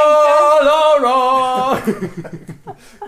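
long, high, loud in-character bellow; yelled password. Acted. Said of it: "Glyph yells".

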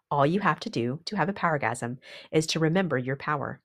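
ordinary talk

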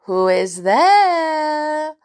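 wolf posing as granny, high and drawn-out; long sing-out question; calling out. A narrator's reading. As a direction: call slowly through the door, loud and high. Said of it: "called a voice".

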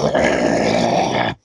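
long, very low growl; werewolf growl. Acted. Improv player turning into a werewolf mid-sentence, low growl.

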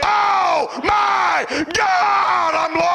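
high, drawn-out wail of a lost sinner; acted cry of horror at judgment; anguished cry. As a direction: stretch each word into a high shout.

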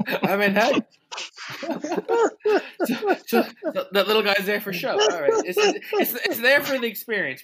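talking through laughter; giggling while speaking; laughing. Real, not acted. Cracking up at Frank.

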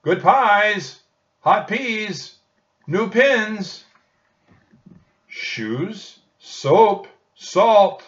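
high, loud market hawker calls; street vendor cries. A narrator's reading. Shopkeepers on the bridge crying their goods. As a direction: cry your wares over the noise. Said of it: "Shopkeepers shouted".

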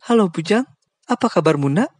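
high, motherly greeting; a kind aunt greeting like a son; friendly Aunt Kim. Acted. Aunt Kim warmly greeting Bujang over speakerphone. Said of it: "Suara Bibi Kim terdengar ramah".

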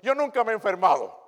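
loud, high bragging claim; boastful mock. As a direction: show off being never sick. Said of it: "unos que vienen jactanciosos". (presumiendo en voz alta)

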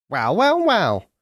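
a sly bird drawing it out; old crow. Acted.

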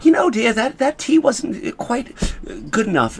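a hammed-up posh British accent, slow. Mocking the British viewers on Gogglebox, mock posh British accent. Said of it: "I can't do the damn, uh, what is it called? Accent".

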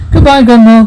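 high, loud cry of goodbye; anguished farewell. Acted. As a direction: call out a desperate last goodbye. Final farewell to dying grandma.

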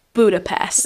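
Budapest with an English drawl, burr-a-pest; English accent in Hungarian. Retold or imitated. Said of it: "amikor valaki azt mondja".

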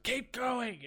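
high loud parental shout. Retold or imitated. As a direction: yell it through the door. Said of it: "they're just like".

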